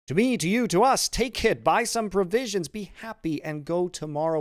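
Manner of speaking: high, loud, excited outburst; joyful urging. A narrator's reading. Son joyfully hands over money.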